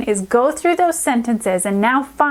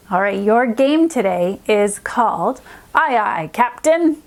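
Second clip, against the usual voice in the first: ordinary talk, nothing marked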